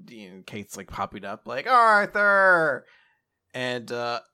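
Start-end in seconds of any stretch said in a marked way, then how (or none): 1.7-2.8 high, loud, drawn-out name; excited calling out. Retold or imitated. Kaith pops up to greet Arthur, excited calling.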